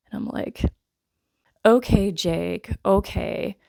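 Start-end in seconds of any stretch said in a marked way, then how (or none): 1.7-3.6 slow, dismissive acknowledgement; unimpressed humouring. Retold or imitated. Viewer answering the hero's corny line, drily unimpressed. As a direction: humour him, clearly unconvinced.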